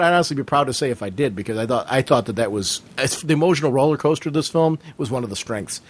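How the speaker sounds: normal talking voice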